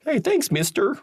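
put-on high small child's thanks; kid voice. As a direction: squeak it like a little kid. Voicing grateful kid.

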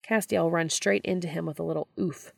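usual speaking voice

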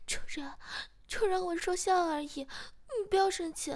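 high, loud panicked pleading; struggling plea. A narrator's reading. Frightened partner begging the master not to be angry, panicked. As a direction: beg urgently while struggling. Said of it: "不停地挣扎着说".

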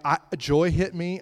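normal talking voice

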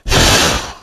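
low, breath-only sigh; resigned sigh. A narrator's reading. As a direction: sigh out the last hope. Answer to her last hope: nope.

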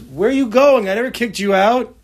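father calling out. Retold or imitated. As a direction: call out after him, hurt and surprised.

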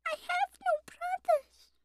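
slow, very high little lamb voice; pleading lamb. A narrator's reading. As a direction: answer meekly in a tiny high voice. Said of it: "pleaded the lamb".

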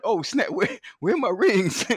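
high-pitched retold panic; mock panic. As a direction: act out frantically missing something. Retells panicking over lost rings.

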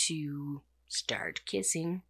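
breathy confiding whisper, no voice at all; hushed whisper. Real, not acted. Telling viewers the couple start kissing, in a hushed whisper. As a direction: let it slip out like a secret. Teasing aside about the kissing.

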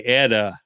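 "Etta" with the tt as a D; Texas D pronunciation. Shown as a demonstration. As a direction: say "Etta" like "Edda". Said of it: "In Texas, we say Etta".

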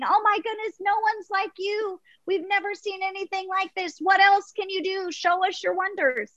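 high-pitched, marvelling excitement; cheering crowd. Acted. Crowd on the ledge hails the tightrope walker, high and excited. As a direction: gush in a high voice like an amazed onlooker.

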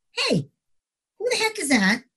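high-pitched mild surprise; puzzled call-out. Retold or imitated. Officer retelling spotting someone by the pond, mildly startled.

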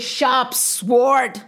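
usual speaking voice

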